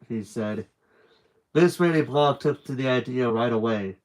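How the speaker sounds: ordinary delivery, nothing marked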